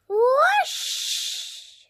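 high, loud whoosh; whooshing sound word. A narrator's reading. Narrator acting out the crab slipping. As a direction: sweep the word up like a slide.

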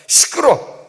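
loud, high acted rebuke; passers-by scolding. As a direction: shout it like an annoyed passer-by. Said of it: "사람들이 지나가면서".

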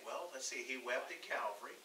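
soft, musing inner voice; quiet thinking aloud; thinking to oneself. Acted. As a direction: drop right down, as if pondering privately. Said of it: "you think".

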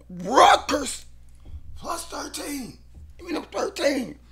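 very high, pleading, playful; high excited falsetto. Real, not acted. Bettor begging for Rutgers plus thirteen, high falsetto. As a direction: squeal for the points.

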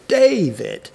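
DAvid, loud first syllable and fading second; strong weak stress. Shown as a demonstration. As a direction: punch DA in David, drop vid. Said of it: "show us that dynamic difference".

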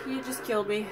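ordinary delivery

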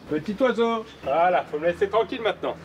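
high sing-song to a bird; talking to a bird. Real, not acted. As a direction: coax the little bird. Addressing a bird.

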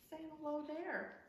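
lively picture-book reading, calls of yes and hooray up high; reading to children. Acted. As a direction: read it to little ones at story time. Reads picture book aloud.

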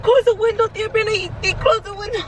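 imitating a scared dog. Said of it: "No, he was like".

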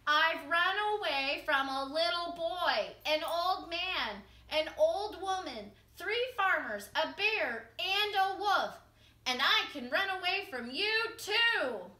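high, loud, full shout. A narrator's reading. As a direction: yell it right at the fox. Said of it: "he shouted".

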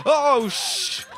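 high, cut-off yelp of fright; startled cry. Real, not acted. Gamer startled by a runner, high cut-off yelp. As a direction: yelp and choke off the curse. Zombie rounds corner.

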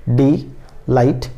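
डी, light, फुल as three separate beats with pauses; sounded out by syllables. Shown as a demonstration. Teacher pronouncing it to count syllables, डी light फुल split apart. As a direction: break the word into डी / light / फुल. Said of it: "हम लोग उच्चारण करके देखेंगे".

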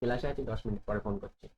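hushed quick aside into the phone; low murmur so the class barely hears; whispering on the phone. Real, not acted. Teacher takes a call mid-class.

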